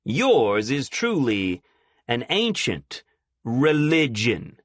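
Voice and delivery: religion slow and loud; emphasis on religion. Acted. Preacher to scientist, stressing religion slowly. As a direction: lean hard on religion. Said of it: "At his emphasis on the word religion".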